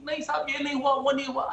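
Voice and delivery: quick, dismissive mock of opponents; mocking denial. Debater mocking rival panelists' denials, quick and dismissive. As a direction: toss off their denial as a sneer. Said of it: "are arguing in this, ki".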